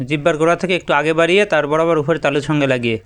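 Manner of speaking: ordinary talk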